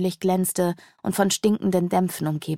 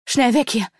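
slightly raised, mildly urgent gasp; gasped urging; 4 semitones higher than usual. A narrator's reading. Sidonia urging the others to flee the blinded angel, lightly gasped. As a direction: urge them away with a touch of breathlessness. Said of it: "keuchte Sidonia".

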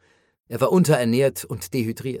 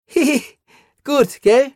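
much higher giggling, louder; delirious giggle; 13 semitones higher, 6 dB louder than usual. A narrator's reading. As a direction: giggle madly, feverish. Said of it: "Er deliriert". (irres Kichern, viel höher und lauter)

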